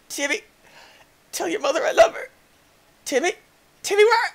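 mock tragic farewell as the character falls; mock dying farewell. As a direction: ham up a last goodbye to Timmy.

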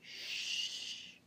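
long hushing shh; shush. Acted. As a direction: hush the room. Librarian hushing.

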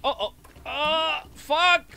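high, loud cry of frustration; frustrated shout. Real, not acted. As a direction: blurt it out in frustration. Boss fight going wrong.

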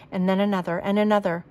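usual speaking voice

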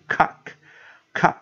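COCK as one syllable with a single final k sound; pronunciation demo. Shown as a demonstration. Teacher explaining the silent K, pronunciation demo. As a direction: repeat COCK separately, short "ah" vowel. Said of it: "其中一个K不发音".

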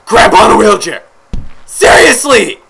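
high, loud disbelief; incredulous shout. Real, not acted. Player mocking the creators' first character, incredulous shout. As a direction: yell it as an absurd choice.

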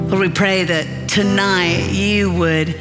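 usual speaking voice

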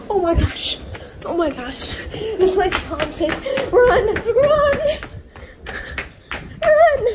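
frightened, climbing high and loud; panicked. Real, not acted. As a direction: panic and flee, voice rising. Panicked flight from haunted floor.

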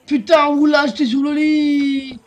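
loud high screaming, building to a shriek; raging gamer. Real, not acted. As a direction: lose it completely at the opponent. Ambushed and killed in game.

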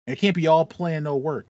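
ordinary delivery

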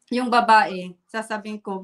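normal talking voice

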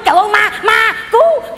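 mock terrified scream, rising high and loud; scream of fright. Wife retelling waking to a shape in the dark, screaming. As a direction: cry out as if seeing a ghost. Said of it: "con la".

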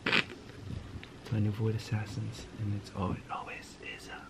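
hushed. Real, not acted. Wife asleep in next room.

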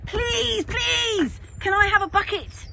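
high, drawn-out begging; pleading in a high child's voice. A narrator's reading. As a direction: beg like an eager little kid. Child begging parents for a bucket.

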